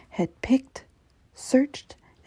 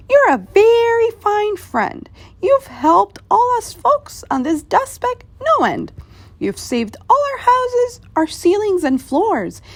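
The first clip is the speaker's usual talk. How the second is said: much higher, small thankful voice from a speck; a tiny mayor thanking his giant friend; tiny grateful Who; 11 semitones higher than usual. Acted.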